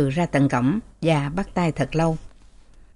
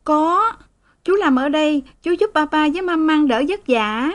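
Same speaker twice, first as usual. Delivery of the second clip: put-on high little girl's voice, tearful plea; pleading child; 9 semitones higher than usual. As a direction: beg papa like a small girl. Child answers father. (giọng bé gái van nài)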